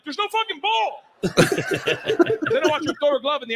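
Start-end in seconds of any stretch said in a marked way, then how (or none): 0.0-1.0 exasperated high yelp. Retold or imitated. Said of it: "I'm like".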